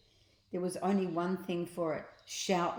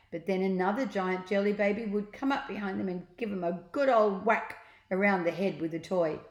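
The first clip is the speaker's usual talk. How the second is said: plain reading voice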